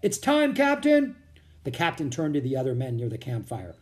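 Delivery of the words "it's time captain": high, loud distant hail; calling out. A narrator's reading. Soldier summoning the captain from upriver, calling out. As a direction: call it out as if across a river. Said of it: "someone called from up the river".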